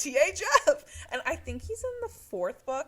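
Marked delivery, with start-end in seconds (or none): 0.0-1.1 high, loud and slow, drawn out; hammed-up drawn-out name; mock grand title. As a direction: savor the silly title. Echoing the full title.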